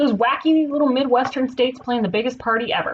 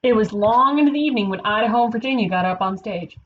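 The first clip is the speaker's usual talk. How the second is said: ordinary delivery, nothing marked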